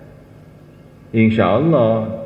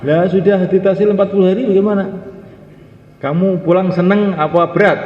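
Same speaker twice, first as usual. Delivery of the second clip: high, loud probing teacher; questioning teacher; 10 semitones higher, 5 dB louder than usual. Acted. Preacher voices elder asking returning worker, raised and probing. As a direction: press the student for an answer.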